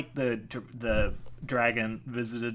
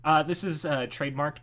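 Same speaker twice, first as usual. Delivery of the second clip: ordinary delivery, nothing marked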